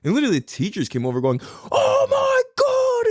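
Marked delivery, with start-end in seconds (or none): 1.7-3.1 very high, drawn-out mock scream; imitated freak-out. Teachers rushing over to an injured kid, panicked. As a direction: mimic panicked teachers, stretching every word up high. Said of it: "freaking out everywhere".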